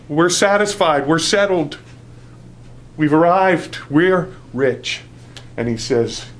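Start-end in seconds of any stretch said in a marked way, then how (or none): 0.1-5.0 loud, high, self-satisfied declaration; preacher voicing a self-satisfied church; smug boast. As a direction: crow about having arrived. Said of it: "that church that thought".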